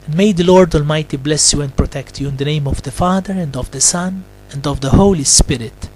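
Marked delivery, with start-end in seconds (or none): none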